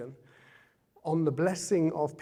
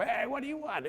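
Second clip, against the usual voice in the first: much higher mock Italian priest answering; 11 semitones higher than usual. Italian priest answers Rocky at his door, mock Italian accent. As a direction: play the gruff Italian priest. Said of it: "this Italian priest puts up".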